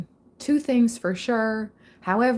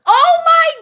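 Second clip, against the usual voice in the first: much higher, loud scream of surprise; shocked shout; 8 dB louder than usual. Real, not acted. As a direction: let the shock burst out. Shocked by the reading.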